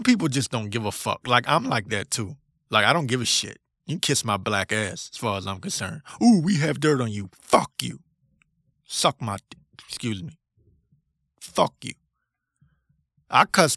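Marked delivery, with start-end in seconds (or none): 6.2-11.9 defiant retort. Retold or imitated. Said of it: "mocking the powers that be".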